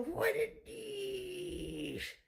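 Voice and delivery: very high, slow, soft falsetto; squeaky put-on voice. Mocking the phrase in a tiny high voice.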